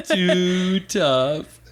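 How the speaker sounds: high laughing repeat of a phrase. Real, not acted. Cohost laughing at Kaladin's line, laughing. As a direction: laugh through the words.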